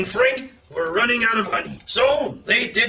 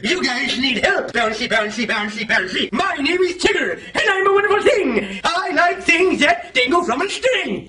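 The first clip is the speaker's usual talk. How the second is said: Tigger; 3 semitones higher than usual. Acted. Tigger introduces himself to Pooh and Frank, bouncy. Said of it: "Tigger came bouncing up and said".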